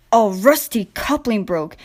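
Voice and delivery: ordinary delivery, nothing marked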